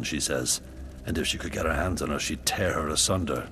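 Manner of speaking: ordinary talk, nothing marked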